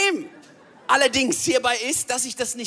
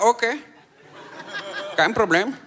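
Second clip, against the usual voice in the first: put-on Indian accent; 6 semitones lower than usual. Restaurant worker answering after a long pause, Indian accent. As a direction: draw it out as an Indian order-taker. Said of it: "Dann sagt er".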